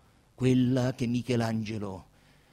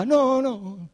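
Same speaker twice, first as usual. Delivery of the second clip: hasty denial; 11 semitones higher, 8 dB louder than usual. Acted. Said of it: "gli risposero".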